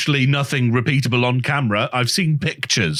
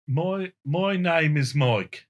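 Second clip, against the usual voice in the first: slow, softer attempt at Brummie; Brummie accent; 5 dB quieter, slower than usual. Mike trying the prompted line for friends, Brummie accent. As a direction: ease carefully into a Brummie accent. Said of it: "gonna do a Brummy accent".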